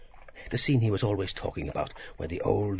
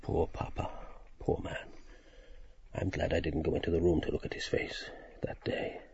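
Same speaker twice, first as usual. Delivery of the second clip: quiet grief; 4 semitones lower, 6 dB quieter than usual. A narrator's reading. Grieving memory of father.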